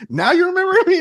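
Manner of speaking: high, loud question through laughter; re-enacted laughing disbelief; amused incredulity. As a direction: tease an old acquaintance loudly, laughing. Retells teasing Dave after recognition.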